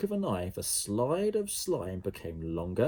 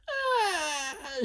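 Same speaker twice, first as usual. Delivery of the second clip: very high scream of alarm. A narrator's reading. Jemima stuck in slime, high scream. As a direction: yelp as the foot sticks. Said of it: "she screamed".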